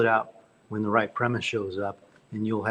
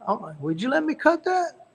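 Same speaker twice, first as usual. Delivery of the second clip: much higher put-on voice asking eagerly; Billy Joe asking; 13 semitones higher than usual. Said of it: "And he said".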